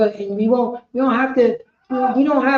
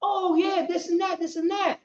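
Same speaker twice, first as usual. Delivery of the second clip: higher, excited teacher recalling him; imitating the teacher; 6 semitones higher than usual. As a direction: play the delighted old teacher, a bit higher.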